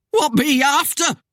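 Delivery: high, loud, strangled question; squeaky croak. A narrator's reading. Cornered man questions Death, in squeaky croak. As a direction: squeak it out in fear. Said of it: "in a squeaky croak".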